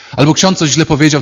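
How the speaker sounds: ordinary talk, nothing marked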